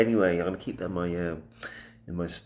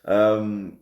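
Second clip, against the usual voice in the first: ordinary talk, nothing marked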